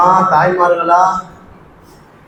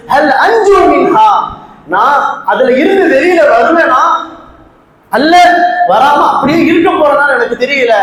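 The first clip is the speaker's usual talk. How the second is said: impassioned, emphatic sermon delivery; preaching; 4 semitones higher than usual. Acted. Preacher retells why a companion wept at a verse, preaching. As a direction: preach it urgently, stretching the Arabic verses.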